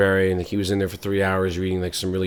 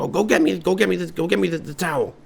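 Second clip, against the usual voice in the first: higher urgent orders; parent in pain; 10 semitones higher than usual. Acted. Burned parent ordering kid to fetch towel, higher.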